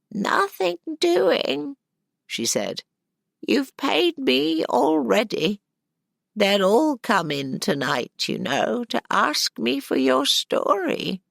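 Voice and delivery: high, slow crone voice; a cheerful stubborn old storyteller; old woman voice. Acted. Old woman refuses payment.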